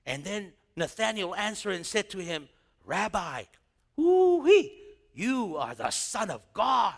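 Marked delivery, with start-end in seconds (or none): 2.8-6.9 higher, louder excited whoop; giddy joyful praise. Retold or imitated. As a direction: gush like a thrilled convert. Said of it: "He got all giddy".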